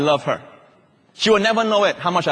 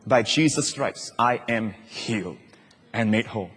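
sense of love; 7 semitones lower, 6 dB quieter than usual. Shown as a demonstration. Confessing in honor of the one who suffered, reverently. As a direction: let each word rest gently. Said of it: "With a sense of his love".

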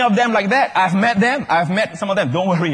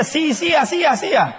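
mock parent call; 9 semitones higher than usual. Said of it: "they hear".

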